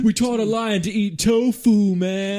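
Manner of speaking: hippie voice. Retold or imitated. Quoting hippie character.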